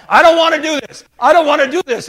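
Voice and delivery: loud, high whining refusal; reluctant protest. Acted. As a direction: dig in your heels, shouting it. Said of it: "he's saying to himself".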